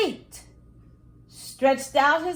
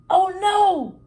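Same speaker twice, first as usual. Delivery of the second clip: louder, higher alarmed oh no; dismayed oh no; 8 semitones higher, 6 dB louder than usual. A narrator's reading. Max realising Toby is winning the race, alarmed. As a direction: realise in a flash you are losing. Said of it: "he thought".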